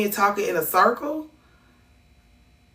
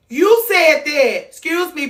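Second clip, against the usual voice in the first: 1 indignant exclamation; 10 semitones higher, 5 dB louder, slower than usual. Real, not acted. Reacting to a comment accusing her.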